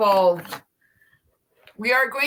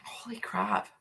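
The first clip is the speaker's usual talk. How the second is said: breathy whispered exclamation; 2 semitones lower, 11 dB quieter, slower than usual. Real, not acted. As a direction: whisper it in disbelief. Reacting to long stream.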